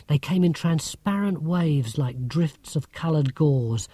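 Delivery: usual speaking voice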